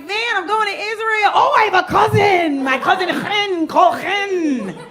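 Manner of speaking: high, loud, eager friend yelling about her cousin; excited shout. Retold or imitated. Excited cousin recommendation, acted.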